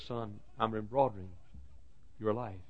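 very soft, hushed reply; gentle divine answer. Acted. The Lord answering his troubled son, hushed and gentle. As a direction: answer the outcry in a quiet voice.

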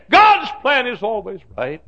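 high, loud preaching cry; impassioned proclamation. Real, not acted. Preacher assuring a widow whose life collapsed, loud impassioned cry. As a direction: ring it out to the congregation. Refrain escalated for grieving widow.

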